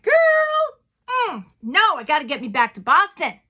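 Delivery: put-on high, loud sassy "Girl" then settling down; sassy girlfriend brushing off a New York boyfriend. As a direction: talk like a sassy best friend. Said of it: "And I was like".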